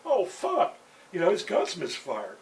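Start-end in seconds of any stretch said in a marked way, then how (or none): 0.0-0.8 high, loud shocked oh fuck; startled curse. Retold or imitated. Narrator realizing the gun misfired, high shocked curse. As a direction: blurt it out in panic. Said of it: "I go".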